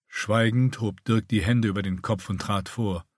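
ordinary delivery

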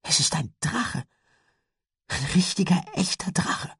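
hoch, gehaucht, ohne Lautstärke; ehrfürchtig staunend hauchen; fassungsloses Staunen. A narrator's reading. Lucinda staunt fassungslos über Drachen. (hohe, ungläubige Stimme)

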